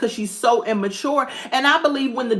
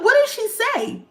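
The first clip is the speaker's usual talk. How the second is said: can't believe what you just heard; shocked disbelief. Retold or imitated. Viewer reacting to psychic making Rich cry, high shocked disbelief. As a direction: pitch jumps high, stunned.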